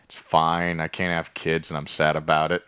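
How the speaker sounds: low, soft, subdued delivery; mock resigned sadness. Dany answering Jon about her dead dragon, mock resigned. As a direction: shrug off the hurt quietly. Retold sad reply in boat scene.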